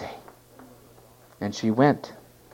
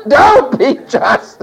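high, loud, slow plea with a laugh; slow loud mock plea to the Lord; pleading prayer; 12 semitones higher, 8 dB louder, much slower than usual. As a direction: beg God, half laughing. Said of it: "I said".

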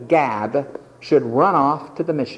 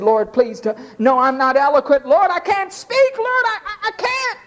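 higher, faltering, stammering plea; Moses' protest; 11 semitones higher than usual. Retold or imitated. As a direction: stumble over words as Moses refusing.

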